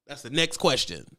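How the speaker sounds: ordinary delivery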